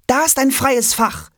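put-on high, eager child call. A narrator's reading. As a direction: call out to your parents, excited. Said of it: "ruft Jonas".

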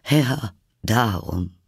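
slow, breath-laden sigh. A narrator's reading. Hatter answers Alice about the teacups, with a sigh. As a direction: sigh the words out slowly. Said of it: "mit einem Seufzer".

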